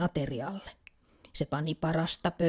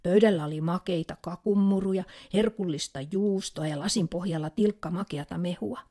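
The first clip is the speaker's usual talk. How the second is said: usual speaking voice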